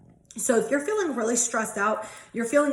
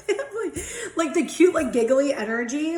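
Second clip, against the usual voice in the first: giggling; 4 semitones higher, 3 dB louder than usual. Real, not acted. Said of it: "I'm so giggly".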